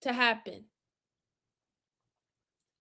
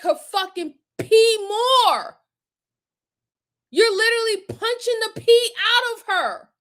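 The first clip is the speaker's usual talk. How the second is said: furious outburst; 8 semitones higher than usual. Real, not acted. Accusing the father of the beatings, high furious outburst. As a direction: spit it out at the top of your voice. Outraged peak of rant.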